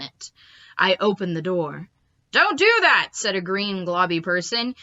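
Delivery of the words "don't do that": high loud cry; alarmed shout. Acted. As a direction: yell to stop him. Alien cries out.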